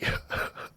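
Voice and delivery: breaking into a soft real laugh; can't hold back a quiet laugh. Real, not acted. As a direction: reader cracking up mid-story, soft laugh. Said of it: "I just keep laughing".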